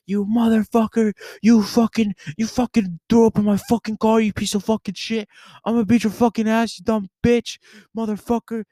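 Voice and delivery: high, strained hypothetical tirade; mock pissed-off rant. Storyteller acts how angry they could have been at friend, mock rant. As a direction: rage high in a put-on fury. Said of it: "completely pissed off at James".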